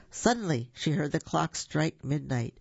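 usual speaking voice

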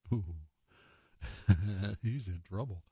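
ordinary talk

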